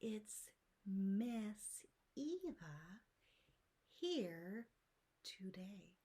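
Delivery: very slow, with pauses between words. Shown as a demonstration. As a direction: stretch each word out and pause after it.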